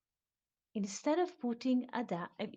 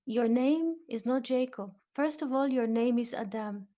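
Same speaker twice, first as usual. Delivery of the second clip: louder, "Your name is not Jacob" declared; emphatic statement; 2 semitones higher, 5 dB louder than usual. Shown as a demonstration. Teacher voices the man telling Jacob his name. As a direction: proclaim it, no question. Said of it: "This is a statement".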